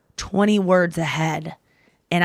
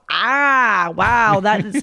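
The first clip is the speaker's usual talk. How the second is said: mock reaction; 3 semitones higher, much slower than usual. Acts out reaction to wasted joke.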